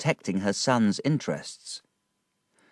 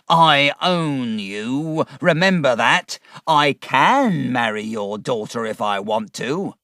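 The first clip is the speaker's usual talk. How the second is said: angry shout; 4 semitones higher, 6 dB louder, slower than usual. A narrator's reading. Uriah threatens Wickfield angrily.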